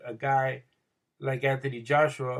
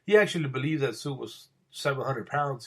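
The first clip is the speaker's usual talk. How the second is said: ordinary talk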